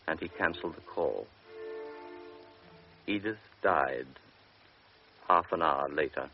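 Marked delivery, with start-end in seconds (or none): none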